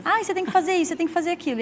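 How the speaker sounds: mock busybody advisers. Campaign busybody telling a candidate what to do, mock pushy advice. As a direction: imitate pitaqueiros butting in with orders. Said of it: "aparece um monte de pitaqueiro".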